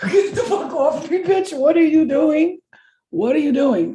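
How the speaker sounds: high, loud shouts of get off me; panicked yell. Retold or imitated. Pinned to the wall, yelling at the cousin to get off. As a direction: yell to shove her away in a panic.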